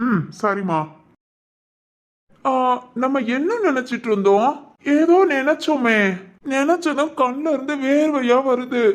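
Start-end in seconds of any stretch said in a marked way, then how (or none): 2.4-8.8 put-on higher, innocent childlike voice; childlike man. Regressed son to his caretakers, higher childlike voice. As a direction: talk like a puzzled ten-year-old.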